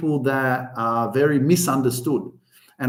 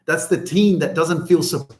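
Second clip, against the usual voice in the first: ordinary talk, nothing marked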